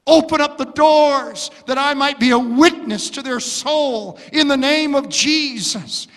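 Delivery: loud, high, slow pleading; agonizing prayer. Pretend. Pastor modeling intercession for the week of prayer, loud and high. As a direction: plead with God for a soul.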